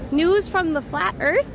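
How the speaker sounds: normal talking voice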